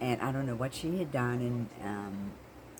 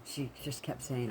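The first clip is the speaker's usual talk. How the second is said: usual speaking voice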